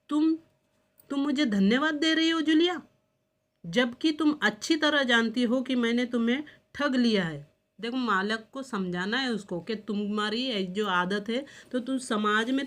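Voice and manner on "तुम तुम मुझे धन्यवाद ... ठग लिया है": ordinary talk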